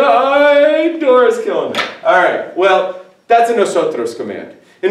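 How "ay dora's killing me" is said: mock agony. Mock suffering after Dora chant.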